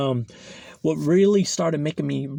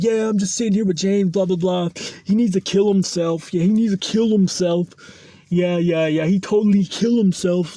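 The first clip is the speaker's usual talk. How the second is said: mocking the man on the phone; 4 semitones higher, 4 dB louder than usual. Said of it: "His girlfriend starts calling him on the phone".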